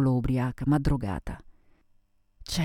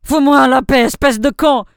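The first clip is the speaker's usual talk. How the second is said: high, very loud furious outburst; sudden violent shout; 11 semitones higher, 13 dB louder than usual. Acted. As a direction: explode at him in French. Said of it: "gridò con improvvisa violenza".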